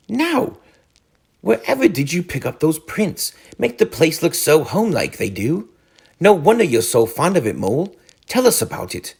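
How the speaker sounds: high, fond, cheery voice; admiring Rat. A narrator's reading. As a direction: praise it warmly. Rat praising Mole's home.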